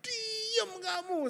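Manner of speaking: high, drawn-out mock yell; exaggerated "shut up" outburst, stretched out; retold snapping. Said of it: "Saya bentak dia".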